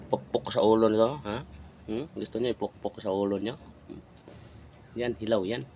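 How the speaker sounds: usual speaking voice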